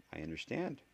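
ordinary delivery, nothing marked

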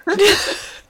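loud high burst of laughter. Real, not acted. Laughing at own story.

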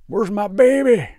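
high, loud, slow wailing mock drunk; drunk mom. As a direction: wail like a sloppy drunk mother.

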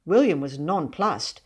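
plain reading voice

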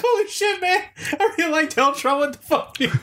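high-pitched, laughing through the words; laughing disbelief. Retold or imitated. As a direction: replay your own stunned giggling reaction. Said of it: "And I was like".

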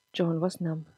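ordinary delivery

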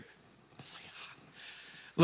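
voiceless, barely-there whisper; stunned whisper. Retold or imitated. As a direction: retell a stunned reaction under your breath. Father retells stunned, sheepish reaction.

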